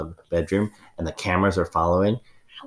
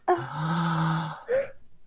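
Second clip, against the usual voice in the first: gasp; 10 semitones higher, 5 dB quieter than usual. Acted. Mother gasps at idea.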